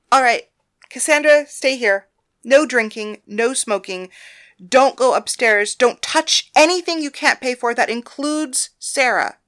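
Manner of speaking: loud, bossy list of rules; stern instructions. Acted. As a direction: lay down the rules firmly. Thalia laying down strict rules.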